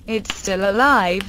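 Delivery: plain reading voice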